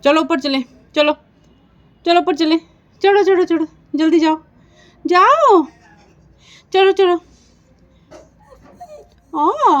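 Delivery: high, loud encouraging calls to a pet; coaxing the dog. Real, not acted. Owner urges her scared dog to climb up, loud high coaxing. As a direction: urge the nervous dog up the stairs, loud and high.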